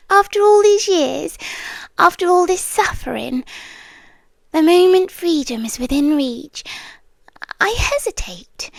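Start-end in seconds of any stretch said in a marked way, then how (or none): none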